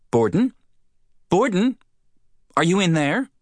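high, loud calling through a door. Acted. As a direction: rap and call for the marshal. Woman knocks, calling out.

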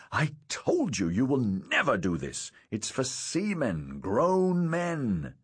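emphatic, mildly angry scolding; fuming. A narrator's reading. Father forbidding his son to climb, fuming. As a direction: lay down the law to a small child.

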